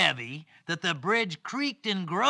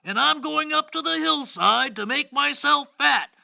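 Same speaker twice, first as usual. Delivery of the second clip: higher-pitched middle billy goat; high storybook goat voice, a little louder; second goat's voice; 8 semitones higher, 4 dB louder than usual. Acted. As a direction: speak up brightly as the middle goat. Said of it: "a little louder than the voice of the first".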